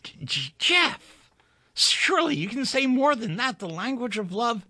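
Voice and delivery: high, hurt pleading woman's voice; dismayed young woman. A narrator's reading. Doris protests his cold words, high and hurt.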